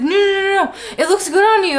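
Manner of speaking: high, loud quick denials; mock flustered backpedal. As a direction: scramble to take it back. [name] backpedaling.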